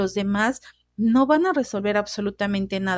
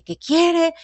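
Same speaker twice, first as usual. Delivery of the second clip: mock yell at boss; 7 semitones higher, 3 dB louder than usual. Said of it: "y le empiezo a gritar".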